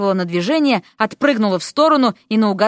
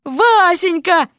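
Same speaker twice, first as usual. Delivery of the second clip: high, stretched joyful cry; hollering; 8 semitones higher, 4 dB louder, much slower than usual. A narrator's reading. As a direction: call the pet name out at length. Same hero keeps hollering her name.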